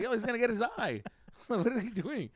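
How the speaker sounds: high-pitched mock-worried protest; half-laughing exasperated warning; retold alarm. Acted alarm at dad's sword gift.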